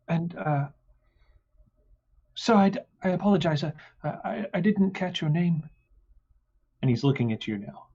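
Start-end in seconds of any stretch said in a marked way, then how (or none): 2.3-5.7 high, fast, nervous; flustered guard. Acted. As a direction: stammer out the apology. Flustered guard asks name.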